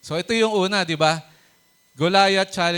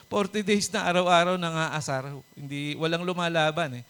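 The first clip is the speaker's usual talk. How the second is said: ordinary talk, nothing marked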